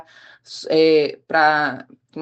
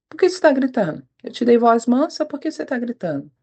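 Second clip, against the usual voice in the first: higher-pitched, insistent questioning; retold reproof; 5 semitones higher than usual. God rebuking a shouting wife, retold with higher-pitched insistence. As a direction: imitate a pressing rebuke.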